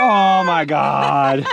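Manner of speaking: relieved delight. Real, not acted. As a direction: draw out the relief. Relief at finding bread.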